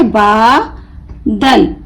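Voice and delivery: बा held long, pause, then दल; broken into syllables. Shown as a demonstration. Teacher showing how to split the word, बा and दल apart. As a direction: say बा... दल as two separate pieces. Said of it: "तोड़ तोड़ कर पढ़ूंगी".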